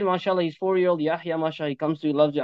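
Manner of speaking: normal talking voice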